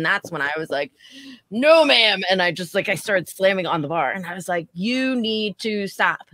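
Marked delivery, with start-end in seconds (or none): 1.5-2.2 loud, sharp "No, ma'am" to shut her down; firm scolding. Retold or imitated. Bartender telling off drunk customer. 4.7-6.3 put-on slow, firm teacher scolding a child; stern teacher voice. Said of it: "Teacher, teacher Val came out".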